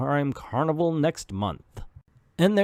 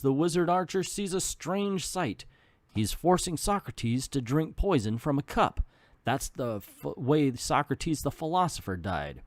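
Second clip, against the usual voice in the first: plain reading voice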